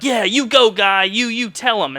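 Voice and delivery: imagined crowd cheering him on. Retold or imitated. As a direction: egg him on like a fan. Imagined cheers from people in line.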